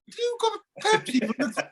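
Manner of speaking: mother voiced high. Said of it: "she'd go".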